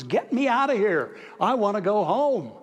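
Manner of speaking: loud, high yell of a princess demanding to go home; acted-out scream, loud and high; retold scream. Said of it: "she screamed at the top of her lungs".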